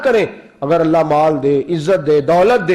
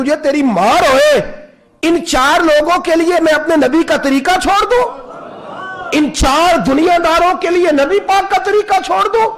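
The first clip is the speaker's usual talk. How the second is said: raised, forceful rebuke, much higher; stern rebuke; 10 semitones higher than usual. Retold or imitated. Umar rebukes the pleading companion, raised and stern. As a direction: thunder it like an offended caliph. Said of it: "سر سے پگڑی اتاری حضرت عمر نے جلال میں".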